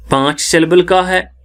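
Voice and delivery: ordinary delivery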